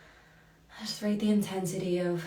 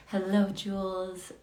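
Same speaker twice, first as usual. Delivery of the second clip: usual speaking voice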